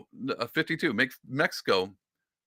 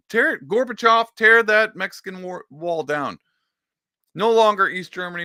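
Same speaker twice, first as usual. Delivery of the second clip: mock grand speech; 7 semitones higher, 5 dB louder than usual.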